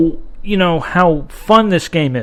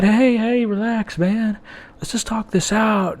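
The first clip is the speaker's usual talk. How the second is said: calmly; 2 semitones higher, 8 dB quieter than usual. Retold or imitated. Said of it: "he just calmly just said".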